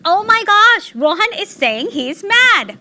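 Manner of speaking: high, loud, drawn-out thrill; delighted gushing. Retold or imitated. Mother reacting to her son naming a feeling, overjoyed. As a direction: gush with amazed joy, stretching the key words.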